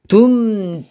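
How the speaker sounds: 둠 cut off short and sharp; staccato. Shown as a demonstration. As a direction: clip 둠 short. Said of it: "구음을 한번 해보겠습니다".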